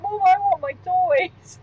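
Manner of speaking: put-on slow whiny little-kid voice for the dog; voicing the puppy. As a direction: play the puppy begging his mom. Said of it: "and he's like".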